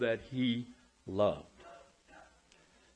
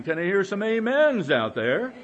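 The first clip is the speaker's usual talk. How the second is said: calling for amens; 6 semitones higher, 7 dB louder than usual. Real, not acted. Calling on congregation.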